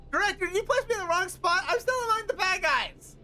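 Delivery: high, whiny sidekick voice; pleading character voice. Acted.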